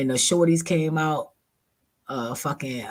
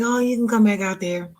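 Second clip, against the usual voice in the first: fake friendly; 9 semitones higher than usual. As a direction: sound welcoming but wary. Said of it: "So I was like".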